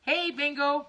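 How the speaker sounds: loud, high-pitched hail; calling out greeting. Real, not acted. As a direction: call out brightly to a neighbour. Calling out to neighbour who is watching.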